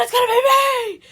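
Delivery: very high, full-volume hammed-up yell; mock scream. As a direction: scream it out in over-the-top imitation. Repeats line, escalating to mock scream.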